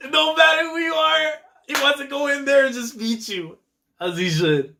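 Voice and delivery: very high, squealed fan excitement; overexcited gushing. Real, not acted. Fan thrilled by a character's will to win, squealing excitement.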